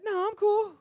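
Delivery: put-on very high, loud sulky girl; mock girl voice. The girlfriend sulking after a fight, shrill and loud. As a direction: squeak it out in a huff.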